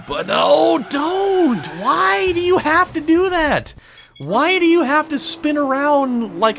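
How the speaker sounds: high-pitched frustrated pleading at the car; exasperated plea. Real, not acted. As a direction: whine at the car to stop spinning out.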